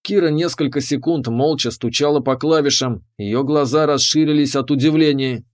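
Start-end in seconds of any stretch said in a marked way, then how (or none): none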